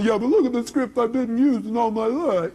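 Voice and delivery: high mock whine; hammed-up protest in a put-on voice; whining objector. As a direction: imitate someone clinging to an excuse. Mocks a listener's whining objection.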